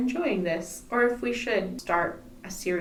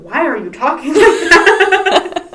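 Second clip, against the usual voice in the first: louder and higher, slow, through laughter; mock friends' question; 9 semitones higher, 15 dB louder, slower than usual. Friends back in Ontario, asked through laughter about her accent. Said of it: "they're going".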